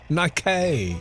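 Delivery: "Nike" as ny-KAY; mock Nike pronunciation. They just decided the name, "Nike" mocked as ny-KAY. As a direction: end "Nike" on a drawn-out kay. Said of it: "They just decided to go".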